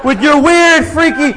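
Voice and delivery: imagined rowdy shout. Retold or imitated. Comedian imagining himself yelling at the barbecue host. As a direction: holler it across the backyard like a bully. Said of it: "now I'm envisioning awful shit".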